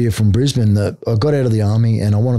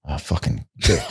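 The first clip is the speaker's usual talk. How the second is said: muttered curse; 6 semitones lower, 6 dB quieter than usual. Retold or imitated. Frustrated aside during retold roll.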